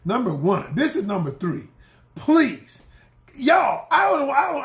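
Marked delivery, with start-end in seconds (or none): 2.1-4.6 high, loud plea to the audience; loud pleading call. Real, not acted. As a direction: raise it up and beg them to listen. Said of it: "I need to scream this louder".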